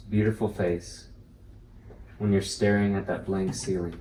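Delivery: deliberate poetry reading, phrase openings lightly pressed; poem recitation. Acted. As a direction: recite it line by line, leaving space after each phrase. Reads own poem aloud to group.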